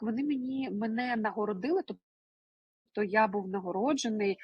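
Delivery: ordinary talk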